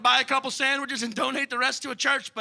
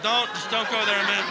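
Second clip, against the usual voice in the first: alien warning; 4 dB louder than usual. Retold or imitated. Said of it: "aliens get when they buzz by Earth and they're like".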